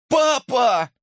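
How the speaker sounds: high cry of recognition; exclaiming. A narrator's reading. Boy hearing his father's voice, exclaiming. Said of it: "воскликнул я".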